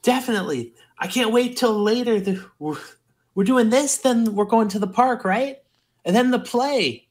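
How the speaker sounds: put-on eager young Raz. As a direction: sound like a thrilled kid.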